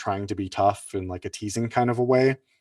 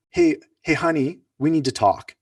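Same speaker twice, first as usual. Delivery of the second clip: partner opening a talk; 6 semitones higher, 5 dB louder than usual. Retold or imitated.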